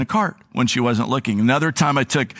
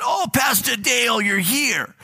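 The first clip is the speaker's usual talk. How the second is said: imitating a neighbor's thick New York accent, higher and calling out; a gushing New York shop clerk calling out, higher; 9 semitones higher, slower than usual. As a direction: do her big friendly New York greeting, called out high. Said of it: "she has this very thick, ... New York accent".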